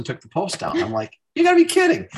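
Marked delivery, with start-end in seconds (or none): 1.4-2.1 very high, loud incredulous cry; mock disbelief. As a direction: exclaim in comic disbelief, voice leaping way up. Retells disbelief at post's removal.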